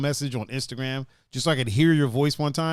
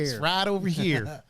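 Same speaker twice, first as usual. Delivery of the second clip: put-on slow, drawn-out Southern accent; mock Southern drawl; 3 semitones higher than usual. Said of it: "why you making fun of us".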